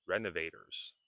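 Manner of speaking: RENOVATORS as reno-vay-ders; lightly tapped D-like T in RENOVATORS; soft flapped T. Shown as a demonstration. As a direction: let the T of RENOVATORS soften to a D. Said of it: "speaking quicker, you can say".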